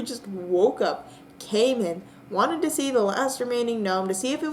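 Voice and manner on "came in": usual speaking voice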